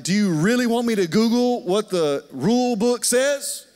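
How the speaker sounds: a rising, half-sung rant about the rule book; escalating exasperation. Retold or imitated.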